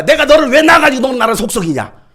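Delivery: loud mock scold of a child; a mother snapping back at her kid. Mother scolds her child back, loud and exasperated.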